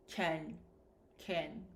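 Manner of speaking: "Ken" said low with a short reduced vowel; can as "ken". Shown as a demonstration. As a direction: let the jaw drop on a low, separate "Ken". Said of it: "sounds like ken".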